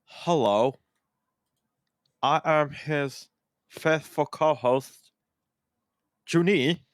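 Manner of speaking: slow, halting robot with long pauses; sad robot. Pretend. Co-host tells listeners they are held hungry, as a sad robot. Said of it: "You sound like a sad robot".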